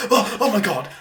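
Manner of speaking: high, loud startled gasp, played for laughs; mock nightmare wake-up, half laughing; mock waking panic. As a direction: jolt awake in hammed-up alarm. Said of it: "You're gonna wake up in the middle of the night".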